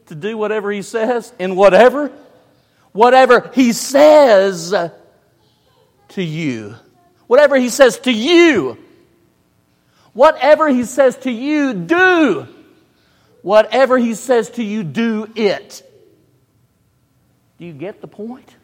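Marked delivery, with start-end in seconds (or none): 2.9-4.7 Whatever he says, loud, high and slow; stress on whatever. Shown as a demonstration. Preacher drilling the verse's emphasis, loud and slow. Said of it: "The emphasis". 7.3-15.8 Whatever he says to you, loud and high each time; emphatic repetition. Shown as a demonstration. Preacher repeating the verse to press the word, loud and high. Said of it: "The emphasis".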